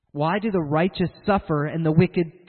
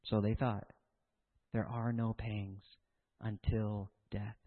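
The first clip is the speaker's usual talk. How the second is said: much softer and lower, slow; hushed verdict; 8 semitones lower, 12 dB quieter, slower than usual. Real, not acted. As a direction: let it fall quietly and gravely. Solemn quiet verdict.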